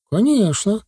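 put-on high boyish brag; touch of pride. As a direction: answer with a little childish pride. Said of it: "не без гордости ответил он". (не без гордости, по-мальчишески)

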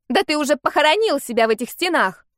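shouting. Acted. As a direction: break into a furious yell. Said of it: "сорвалась на крик леди Грабон".

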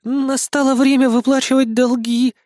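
high, hesitant woman's voice; very unsure. A narrator's reading. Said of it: "крайне неуверенным голосом начала".

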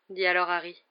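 usual speaking voice

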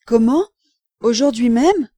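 loud, astonished echo question; startled disbelief. A narrator's reading. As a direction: jump at the news, aghast. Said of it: "Monsieur Barbette fit un bond".

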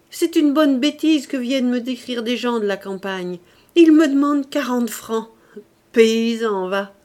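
loud, merry scorn; gaieté moqueuse. A narrator's reading. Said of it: "à être si gaie". (gaieté railleuse, voix forte et plus haute)

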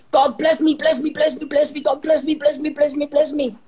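high pleading chant of "bless me"; begging prayer. Retold or imitated. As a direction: whine it like a needy beggar. Mocking old-covenant begging prayer.

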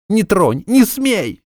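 high sobbing scream; panicked sobbing scream. A narrator's reading. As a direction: scream through the sobs. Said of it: "снова разразилась рыданиями".

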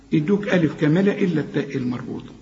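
ordinary talk

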